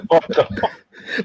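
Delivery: breathless laughing. Real, not acted. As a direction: wheeze it out laughing. Laughing disbelief.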